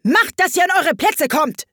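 high, shouted royal order; furious queen's command; thundering. A narrator's reading. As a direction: bellow them into position. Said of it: "donnerte die Königin".

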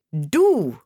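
one scornful, swooping word; contemptuous. A narrator's reading. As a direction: throw it out with disdain. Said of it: "sagte die Raupe verächtlich".

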